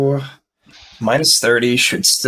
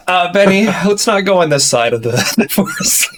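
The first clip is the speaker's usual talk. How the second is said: laughing; 7 semitones higher than usual. Real, not acted. Laughing relief after close call.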